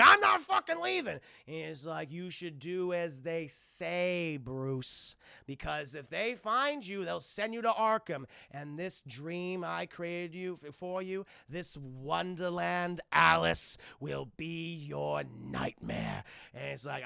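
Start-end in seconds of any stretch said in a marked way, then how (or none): 0.0-1.2 much higher, loud yelled refusal; defiant shout. Retold or imitated. Bruce shouting down at police, defiant yell. 2.1-16.2 slow, lightly voiced sinister villain; sinister warning. Mad Hatter warning Bruce, slow sinister voice.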